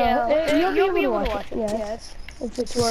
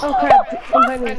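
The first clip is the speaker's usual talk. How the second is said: loud startled cry; 5 dB louder than usual. Real, not acted. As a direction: blurt it out in alarm. Sudden alarm in game.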